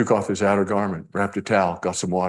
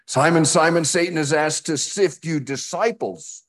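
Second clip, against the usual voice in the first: emphatic reading; 8 semitones higher than usual. Acted. Reading NIrV verse.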